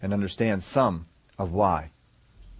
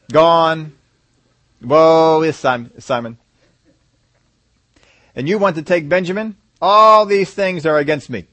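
loud despairing Jacob; 4 semitones higher, 7 dB louder than usual. Retold or imitated.

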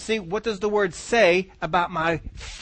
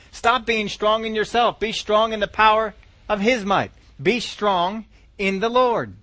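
usual speaking voice

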